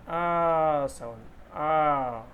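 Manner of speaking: आ very drawn out; long aa. Shown as a demonstration. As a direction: hold आ slowly. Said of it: "number one में क्या है ये".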